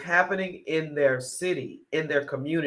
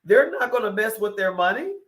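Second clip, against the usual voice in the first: normal talking voice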